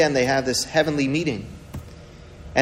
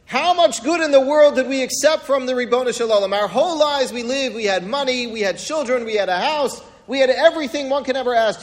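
impassioned rebuke; 8 semitones higher than usual. Acted. Job answering his wife's call to curse God, impassioned rebuke. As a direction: plead and scold in a raised pitch.

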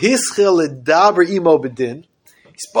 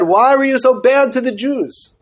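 pleading protest; 9 semitones higher than usual. Acted. Moshe protesting to God.

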